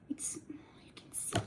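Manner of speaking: breathy whisper aside. Real, not acted. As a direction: whisper it to the camera. Whispering aside near camera.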